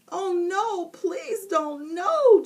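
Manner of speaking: high, rising to a loud final no; plead, then wail the last no. A narrator's reading. Boy about to be doused with water, pleading. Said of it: "Jason pleaded".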